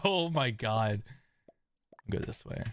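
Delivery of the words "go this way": whispered. Real, not acted. Unvoiced aside.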